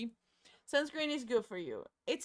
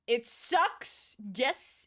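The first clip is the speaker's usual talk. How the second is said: ordinary talk, nothing marked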